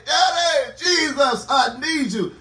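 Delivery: high, loud plea to a father; impassioned, high-pitched preacher's cry. Acted. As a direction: call out urgently for help. Believer crying out for help.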